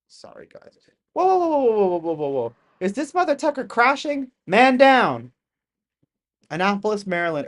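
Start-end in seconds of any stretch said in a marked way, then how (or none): 1.1-2.5 high, loud whoas, then calming down; startled whoas. Real, not acted. Streamer watching a UFO crash clip, startled, then settling. 2.8-5.3 high, loud excited exclamation; excited alarm. Real, not acted. Streamer watching an object fall, loud excited alarm. Reacting to crash footage.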